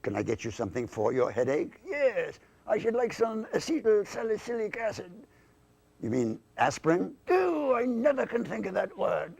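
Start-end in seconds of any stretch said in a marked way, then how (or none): 1.9-5.1 high-pitched British toff; English lord. Acted. English lord asks for headache remedy, high British voice. 7.3-9.3 high-pitched British toff; exasperated British puppet, pitched high; English lord. Acted. English lord lost for a word, high exasperated British voice.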